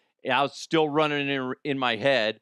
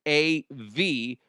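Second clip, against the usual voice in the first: spelled out slowly; 2 semitones higher, much slower than usual. Shown as a demonstration. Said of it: "use promo code bleav".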